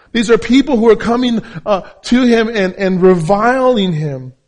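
high, loud, emphatic sermon delivery; full-force preaching. Real, not acted.

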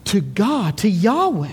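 ordinary talk, nothing marked

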